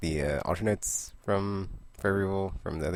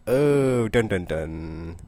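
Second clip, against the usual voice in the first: dramatic cliffhanger tease; 4 semitones higher, 6 dB louder than usual. Real, not acted.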